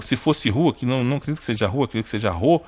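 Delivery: ordinary talk, nothing marked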